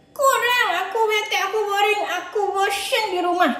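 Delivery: put-on a whiny little boy who keeps complaining; sulky puppet boy. Puppet Adit voice.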